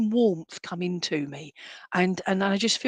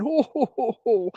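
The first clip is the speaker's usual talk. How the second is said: higher, jolly ho-ho-ho from the chest; a spirit mum laughing from her chest; mock hearty laugh; 5 semitones higher than usual. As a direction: chuckle it out like a jolly belly laugh. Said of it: "she's laughing at that and she's going".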